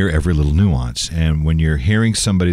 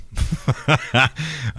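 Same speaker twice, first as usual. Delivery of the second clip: brief, higher-pitched chuckle; short laugh; 7 semitones higher, 4 dB quieter than usual. Real, not acted. Host fumbles the year-end plug, short amused laugh. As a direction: laugh it off quickly.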